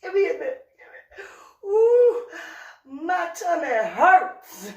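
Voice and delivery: high, breathless words through laughter; laughing. Real, not acted. Laughing so hard her tummy hurts, gasping words out. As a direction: gasp it out mid-laugh.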